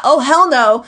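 loud and emphatic; loud sassy refusal. Retold or imitated. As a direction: belt out the refusal. Shadow voice quoted loudly.

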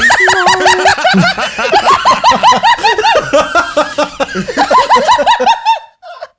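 loud, high burst of laughter; real big laugh. Real, not acted. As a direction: laugh long and helplessly. Laughter at the stall story.

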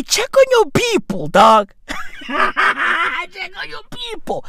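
high, laughing shout of the punchline; laughing callout. Real, not acted. Telling viewers to check on their people, laughing shout. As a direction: yell it out through laughter.

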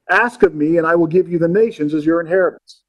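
preacher reading the psalm aloud to the congregation; scripture reading. Acted.

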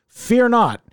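loud, high announcement; mock-heroic proclamation. Reassuring listeners about the falling out, mock-heroic proclamation. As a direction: proclaim it grandly, lifting pitch and volume.

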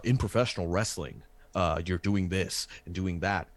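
imitating his own early podcast voice; fake radio voice. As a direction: play the stiff wrestling-podcast host. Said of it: "I was talking more like this".

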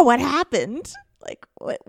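normal talking voice

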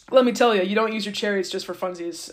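ordinary talk, nothing marked

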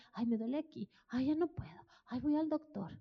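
soft, whining mock of a complaining believer; a touch of self-pitying whine; whiny complainer. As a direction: lightly play a moaning hypochondriac. Mimicking a complainer.

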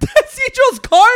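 high, loud and slow through laughter; cracking up as you say it; laughing. Real, not acted. Mocking his friend's car to the group, laughing.

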